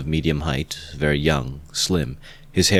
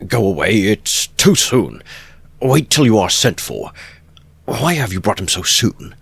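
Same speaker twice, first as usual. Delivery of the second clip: louder, irritated scolding; extremely annoyed; 4 semitones higher, 5 dB louder than usual. A narrator's reading. Porfiry scolding the warders at the door, louder annoyance. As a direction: scold them for coming too soon. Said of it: "muttered, extremely annoyed".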